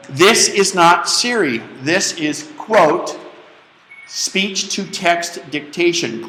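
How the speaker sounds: slow, deliberate, punctuation spoken aloud; dictation voice. Shown as a demonstration. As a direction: dictate it clearly into the microphone. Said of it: "You must speak your punctuation".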